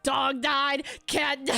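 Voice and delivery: punchy streamer voice, breaking into laughter; imitated streamer delivery with a laugh; XQC impression. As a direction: blurt it as a mock streamer, cracking up. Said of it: "hearing X Q C's voice in my head".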